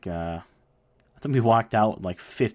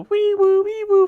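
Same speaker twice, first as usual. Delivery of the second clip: high, louder wee woo siren noise; siren imitation; 10 dB louder, slower than usual. Telling friends how the sheriff lit up, siren imitation. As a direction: mimic a police siren. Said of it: "turned on his lights".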